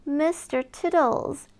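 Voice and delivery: ordinary delivery, nothing marked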